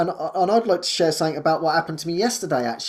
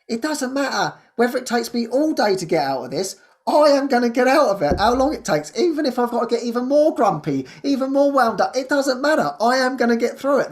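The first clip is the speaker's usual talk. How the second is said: fierce determination; 7 semitones higher than usual. Real, not acted. Vowing to herself she will beat the grumpy mood, determined. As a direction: vow it with rising conviction.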